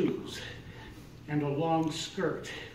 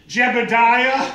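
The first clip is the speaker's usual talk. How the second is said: loud, higher, slow call across the yard; calling Jebediah; 6 semitones higher, 11 dB louder, slower than usual. Retold or imitated. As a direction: call the son from afar. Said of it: "This woman says".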